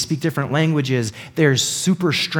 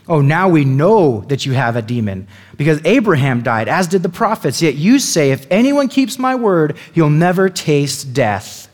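indignant accusation; 2 semitones higher, 4 dB louder than usual. Acted. Accusers throwing Jesus's words back at him, indignant.

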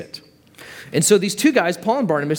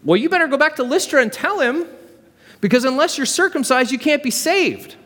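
high, fast, insistent busybody; pushy high-pitched legalist; nosy heckler; 10 semitones higher than usual. Acted. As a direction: press the demand from up high and quick. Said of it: "And the guy's like".

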